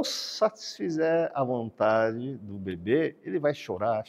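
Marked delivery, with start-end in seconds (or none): none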